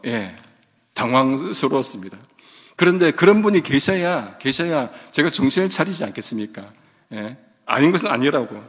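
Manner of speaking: ordinary delivery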